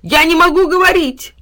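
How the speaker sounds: high, loud muffled cry; panicked dog. A narrator's reading. Dog yelping as he is gagged. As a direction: struggle to get it out. Mouth being tied.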